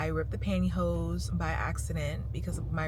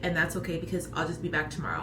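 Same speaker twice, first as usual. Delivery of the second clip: usual speaking voice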